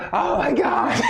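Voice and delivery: high laughing oh my God; relieved gasp. Acted. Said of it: "He's like".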